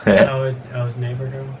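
low, deadpan delivery; underwhelmed, low-pitched murmur; unexcited. Real, not acted. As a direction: say it with no excitement at all. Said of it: "don't sound so fucking excited".

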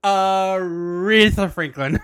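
slow, stretched mock introduction; drawn-out announcement. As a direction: stretch out the star's name like an emcee. Draws out the singer's name.

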